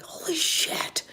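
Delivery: high, slow breathless whisper of amazement; awed whisper. Retold or imitated. Fan seeing the Falcon fly by, high awed whisper. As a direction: gasp it out, stunned by the Falcon. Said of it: "and it's like".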